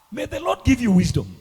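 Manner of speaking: ordinary talk, nothing marked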